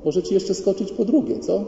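very high, sugary wifely coaxing; imitated fake-sweet high voice; mock sweetness. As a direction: coo at a husband while hiding inner fury. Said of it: "Ja ci złego słowa nie powiem, a w środku".

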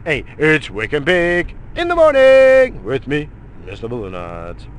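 show intro. Acted. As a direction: announce the show like a host. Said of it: "show intro greeting".

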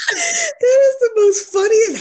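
high, helpless laughing speech; cracking up. Real, not acted. Host tickled by co-host's greeting, cracking up. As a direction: squeal it through real laughter.

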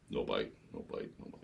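very low, soft running count of failures; hushed, let-down storytelling; deflated muttering. Acted. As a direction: mutter it low, almost to yourself. Re-enacts trolling with no bites.

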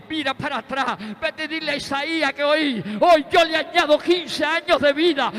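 loud, rising preacher's cry to the congregation; full-force preaching. Acted. Preacher proclaiming God's promise of healing to the church, loud and rising.

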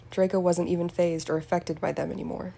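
ordinary delivery

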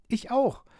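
very high, tiny flower voice; eager small creature voice; dandelion chiming in. A narrator's reading. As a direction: chime in with a little high pipe. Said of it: "warf der Löwenzahn ein".

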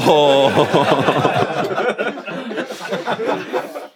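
long rising real laugh; real laughter. Real, not acted. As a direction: let the laugh run and climb. Narrator laughing again.

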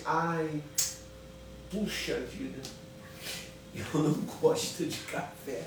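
hushed, half-whispered sigh of reluctance; soft reluctant complaint. Retold or imitated. Wife softly tells the maid she dislikes coffee, hushed. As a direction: whine it quietly. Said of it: "virou pra menina e falou assim".

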